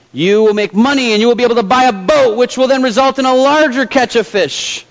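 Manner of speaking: lightly voiced pushy businessman; insistent businessman. A narrator's reading. Businessman pressing his case to fisherman.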